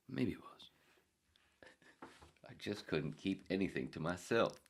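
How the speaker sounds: ordinary talk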